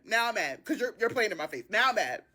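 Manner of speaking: ordinary delivery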